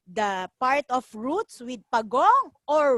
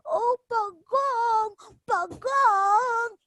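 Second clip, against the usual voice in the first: pleading monkey; 8 semitones higher, much slower than usual. Acted.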